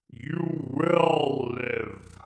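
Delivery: slow, cold alien command; Dalek Emperor order. Acted. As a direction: order it like a machine ruler, slow. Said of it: "repeated the Emperor".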